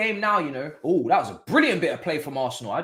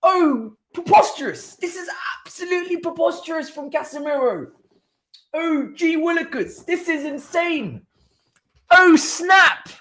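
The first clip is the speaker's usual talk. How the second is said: hammed-up mock shock; 10 semitones higher, 4 dB louder than usual. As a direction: play a scandalised old-timer clutching his pearls. Continues mock-outraged exclamations.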